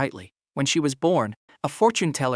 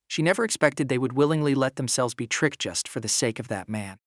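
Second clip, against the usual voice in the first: ordinary delivery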